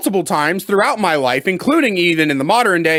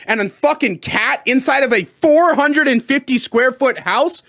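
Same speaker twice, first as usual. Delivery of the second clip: normal talking voice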